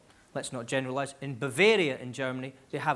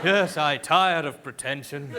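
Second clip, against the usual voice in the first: slow, loud mock grandeur; hammed-up pompous weariness; pretentious; 6 dB louder, slower than usual. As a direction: declaim it slowly, as from a grand stage. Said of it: "some of the pretentious food".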